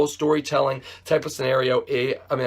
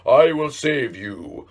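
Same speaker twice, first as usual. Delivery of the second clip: King Triton; 3 dB louder, slower than usual. Retold or imitated. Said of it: "he was all King Triton".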